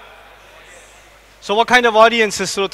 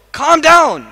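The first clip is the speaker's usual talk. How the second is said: a louder, higher "Calm down"; 5 semitones higher, 5 dB louder than usual. Shown as a demonstration. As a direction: bark it firmly at him. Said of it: "Okay, so".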